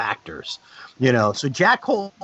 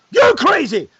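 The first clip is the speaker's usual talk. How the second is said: mock yell; 10 semitones higher, 8 dB louder, slower than usual. Actor yelling the wrong line during a line drill, loud=116. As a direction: shout it high and loud, hammed up. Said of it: "you respond".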